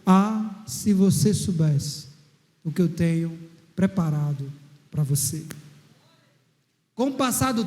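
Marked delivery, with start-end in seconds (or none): 0.1-5.4 voice of God. Acted. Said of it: "A voz continuou".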